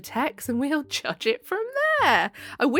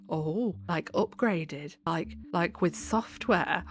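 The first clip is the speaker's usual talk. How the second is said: quiet aside; 3 semitones lower, 9 dB quieter, slower than usual. Real, not acted.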